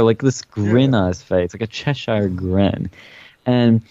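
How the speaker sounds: normal talking voice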